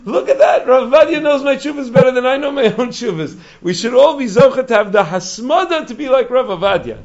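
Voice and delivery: high, loud retold astonishment; amazed admiration. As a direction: exclaim in awe, imitating the rabbi. Retold rabbi marvels at the discovery.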